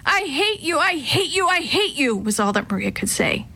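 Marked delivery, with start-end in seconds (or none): 0.1-2.2 put-on high-pitched, repeated childlike tantrum; upset outburst. A narrator's reading. Maria losing control at her brother, upset outburst. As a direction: let it spill out, pitched way up.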